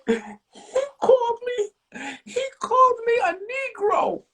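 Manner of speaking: put-on high, slow, wailing sob of a girl; imitated crying. Girl sobbing to the librarian about the name, high wail. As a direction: whine it through tears like a hurt kid. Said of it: "Oh, stop crying".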